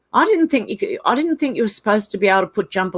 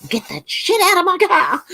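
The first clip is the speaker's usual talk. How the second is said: high-pitched Karen demand, played for laughs; mock Karen; 10 semitones higher than usual. Streamer mimics complaint call to car company, hammed-up Karen. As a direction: demand it like a pretend outraged customer. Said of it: "if we acted like Karens and rang them".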